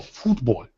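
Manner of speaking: "football" low and soft, first vowel long as in food; put-on foreign pronunciation. Shown as a demonstration. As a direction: say "football" as FOOT-bol with an "oo" as in food. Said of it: "you have to say it that way".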